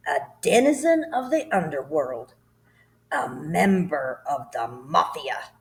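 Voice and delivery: slow, loud, venomous tirade; ranting headmistress. Acted. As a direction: savour each insult to the crowd. Headmistress denounces the boy.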